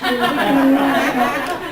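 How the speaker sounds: a flustered, teary little laugh; real embarrassed giggle; emotional laugh. Real, not acted. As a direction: laugh as the feeling catches you. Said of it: "I just look at him and I just get emotional".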